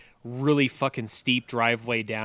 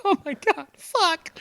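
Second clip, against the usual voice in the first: much higher, strained exclamation; mock panic; slower than usual. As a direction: squeak it out in alarm. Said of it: "and I'm like".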